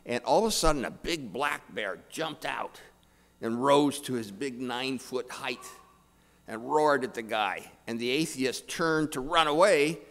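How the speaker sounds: usual speaking voice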